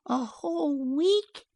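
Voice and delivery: put-on high, drawn-out child's cry; dismayed little girl. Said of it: "she cried, dismayed".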